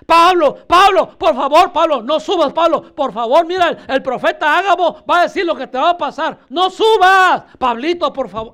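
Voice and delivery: loud, high, insistent begging; acted frantic plea; urgent pleading. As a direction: beg him desperately, piling plea on plea. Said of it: "Eso es rogar, hermano".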